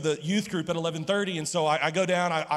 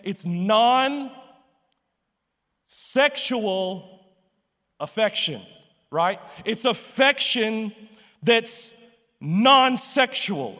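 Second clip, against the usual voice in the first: slow, drawn-out and louder, every word spaced; said real slowly; 4 dB louder, slower than usual. Shown as a demonstration. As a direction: spell it out slowly for the congregation. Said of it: "I'm gonna say this real slowly".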